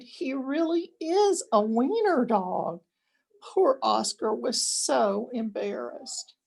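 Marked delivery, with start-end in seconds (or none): 0.0-2.8 much higher, jeering call; mocking cry. A narrator's reading. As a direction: point and jeer at him. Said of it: "they cried".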